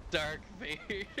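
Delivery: amused giggling; laughing. Real, not acted. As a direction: say it through a high laugh. Laughs repeating the name.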